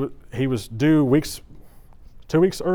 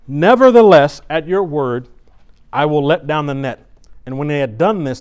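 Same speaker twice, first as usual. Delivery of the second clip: loud, ringing scripture read from the pulpit; 2 semitones higher, 8 dB louder than usual. Acted. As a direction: proclaim the verse to the congregation. Preacher reading scripture aloud.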